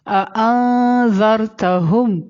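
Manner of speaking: اانذرتہم with the n held long through the nose; ikhfa. Shown as a demonstration. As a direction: hum the hidden n of اانذرتہم, slowly. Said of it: "آ پر اخفا ہوگا". (اانذرتہم میں نون ناک میں چھپا کر)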